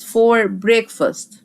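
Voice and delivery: "for breakfast" stretched slowly word by word; slow teacher dictation. Shown as a demonstration. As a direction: spell it out slowly for the class. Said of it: "اسمها for breakfast".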